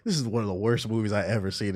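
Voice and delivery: low, quiet grumble; playing it cool. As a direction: grumble it under your breath, keeping it low-key. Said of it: "I was trying to be cool".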